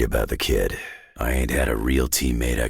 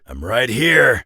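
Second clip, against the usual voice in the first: loud call across the fight; brash shout; 9 semitones higher, 6 dB louder, slower than usual. Acted. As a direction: shout it out to be found. Combat bark in game.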